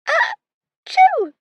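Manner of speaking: sudden explosive sneeze, very high; loud sneeze. A narrator's reading. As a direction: let out a big sneeze. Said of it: "The sneeze was followed".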